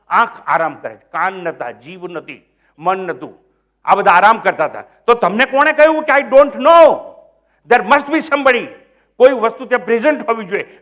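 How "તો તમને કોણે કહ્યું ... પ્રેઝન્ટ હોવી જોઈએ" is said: forceful question. Real, not acted. Preacher presses the question.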